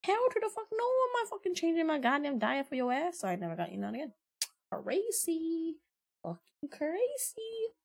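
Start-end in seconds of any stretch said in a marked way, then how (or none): 0.0-3.2 much higher, indignant retold rant; replaying how she snapped at her boyfriend, high and outraged; retold outrage. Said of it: "I'm like". 4.7-7.7 disbelief. Real, not acted. Disbelief at ex's request.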